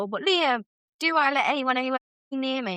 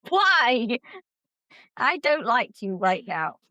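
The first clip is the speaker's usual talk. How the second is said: ordinary delivery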